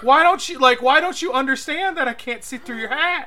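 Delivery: loud, high exasperated plea. Retold or imitated. Loud high plea to the guy about the hat. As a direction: beg him to understand.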